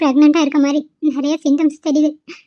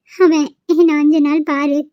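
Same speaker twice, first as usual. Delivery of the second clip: crying. Acted. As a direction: speak while weeping. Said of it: "அழுகாத ரெஜி".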